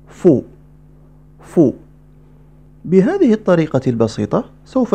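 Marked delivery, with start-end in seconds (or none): 0.1-1.7 فو blended into one louder syllable; joined syllable. Shown as a demonstration. Teacher blending sounds: crisp فو. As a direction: join them: فو. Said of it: "ثم الصوت الذي يليه".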